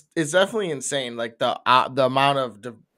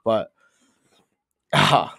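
normal talking voice

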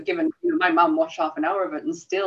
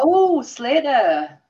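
slow, higher quote voice; 7 semitones higher, 5 dB louder, much slower than usual. Retold or imitated. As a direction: drawl it like the show. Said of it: "You've gotta watch Only Fools and Horses".

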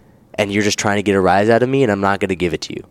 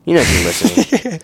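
higher put-on mocking voice; 7 semitones higher than usual. Teasing the listeners in a higher put-on voice. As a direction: say it like a cheesy host.

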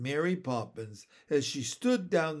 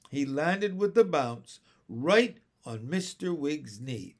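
ordinary delivery

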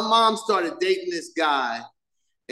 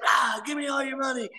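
high mock shout, a kid playing bandit; boy holding up his sister, mock shout; kid robber; 8 semitones higher, 3 dB quieter than usual. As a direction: bark it like a toy-gun robber.

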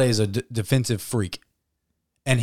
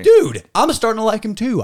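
excited; 9 semitones higher, 5 dB louder than usual. Real, not acted. Enthusiastic praise.

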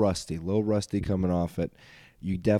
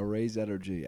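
usual speaking voice

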